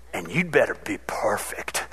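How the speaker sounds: low, breathy whispered warning; a parent's low whispered threat to the kids. Retold or imitated. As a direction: lean in and hiss the threat softly.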